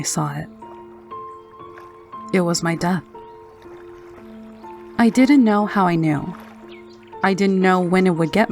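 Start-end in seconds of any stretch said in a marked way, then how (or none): none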